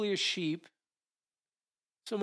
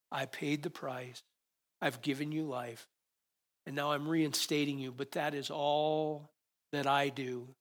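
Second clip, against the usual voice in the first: tender God; 2 semitones lower than usual. Acted. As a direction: soothe the sheep, unhurried. God speaking gently to sheep.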